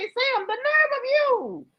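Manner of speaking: high, slow, scolding; high, drawn-out indignation; indignant. Retold or imitated. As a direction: call him out slowly and high. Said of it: "I say".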